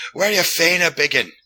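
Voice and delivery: high, loud-ish Glasgow pub slur; thick mock Glasgow accent; mimicked Glaswegian. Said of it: "he's all bleary-eyed".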